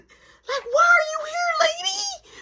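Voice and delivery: finding mom waiting in the office, shocked cry; shocked outcry. Retold or imitated. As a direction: wail it as if betrayed. Shocked to find mom there.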